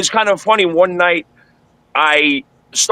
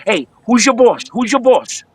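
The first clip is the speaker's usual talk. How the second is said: much higher, tough mobster voice; a pitched-up tough-guy imitation; imitating Gandolfini; 6 semitones higher than usual. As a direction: play the big mob boss hailing an old buddy across the bar. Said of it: "He goes".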